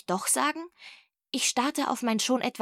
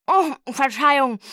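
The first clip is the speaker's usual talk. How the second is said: higher, louder mumbled oops; mouth-full apology, pitched up; 4 semitones higher, 7 dB louder than usual. A narrator's reading. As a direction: apologise indistinctly, as through cake crumbs. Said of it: "nuschelte sie".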